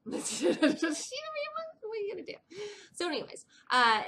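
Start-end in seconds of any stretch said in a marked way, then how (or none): 0.1-2.3 giggling resignation; laughing. Real, not acted. Laughing at the absurdity.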